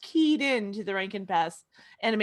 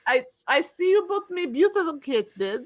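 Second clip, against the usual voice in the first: mock-Russian cat-man voice; Russian accent. Actor as cat admiring his bed, Russian accent. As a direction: put on a broad Russian accent, dropping small words. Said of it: "so now he's just Russian".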